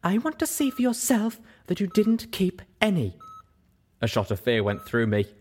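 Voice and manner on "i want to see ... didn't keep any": insisting. Acted. Velvet insisting.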